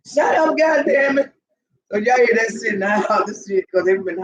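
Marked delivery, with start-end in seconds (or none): 0.1-1.3 high-pitched scolding yell; exasperated mock yell. Owner breaking up a dog sing fest, high mock yell. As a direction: call out high at noisy dogs.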